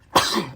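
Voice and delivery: sudden loud sneeze. Real, not acted. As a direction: sneeze out hard. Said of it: "I'm gonna sneeze".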